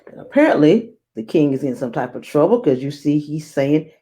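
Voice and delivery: usual speaking voice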